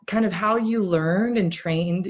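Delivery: usual speaking voice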